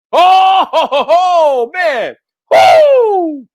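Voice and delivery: loud gloating laugh and whoop; triumphant laugh. Real, not acted. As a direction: cackle with glee, then whoop. Laughs triumphantly at own point.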